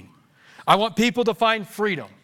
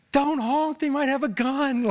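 lightly imitated worried passenger, higher pitch; raised-pitch mock caution; voicing Rita's warning; 6 semitones higher than usual. Preacher imitating Rita warning him off the horn, mock warning. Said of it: "Rita will be like".

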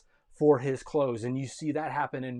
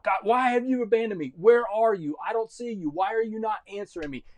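crying out; 6 semitones higher, 5 dB louder than usual. Acted. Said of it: "Look up, cry out".

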